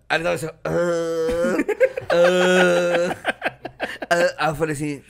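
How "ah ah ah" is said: long mock groan; drawn-out moan, held on one note; groaning in imitation of a sick friend; imitated moaning. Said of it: "Aí ele tava gemendo já".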